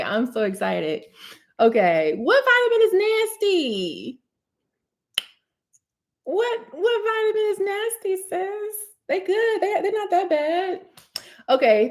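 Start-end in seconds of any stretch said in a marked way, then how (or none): 2.2-10.9 playful reply. Real, not acted. Reading and answering a viewer comment.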